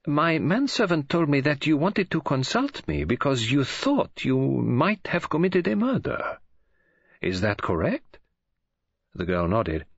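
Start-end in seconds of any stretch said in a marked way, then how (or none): 0.1-8.2 put-on accented detective, higher, carefully prompting; helpful Poirot. A narrator's reading. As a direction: prompt them helpfully, slowing toward the end. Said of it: "He said helpfully".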